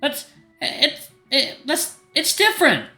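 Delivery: high, stammering protest; flustered denial. Acted. Caught out over spoiling a friend, flustered. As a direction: stumble into a high-pitched flustered excuse.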